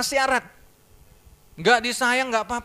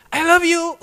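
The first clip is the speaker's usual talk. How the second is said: high, loud call of I love you from another room; wife calling I love you loudly from inside; shouted from inside; 9 semitones higher, 9 dB louder than usual. Retold or imitated. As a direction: shout it up and out so it carries through the house. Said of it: "teriak dari dalam".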